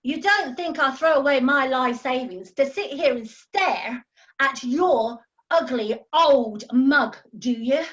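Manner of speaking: loud, scornful tirade; angry outburst. Acted. Tiffany scolding Fred over his ugly old mug, loud and scornful. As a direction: let him have it, loud and cutting.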